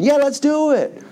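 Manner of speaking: high-pitched mock party excitement; eager agreement. Israelites agree to make a golden calf, excited. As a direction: jump in as the keen reveler.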